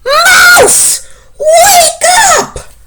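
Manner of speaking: high, very loud shout; yelled. Acted. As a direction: yell to wake the mouse. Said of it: "And he yelled".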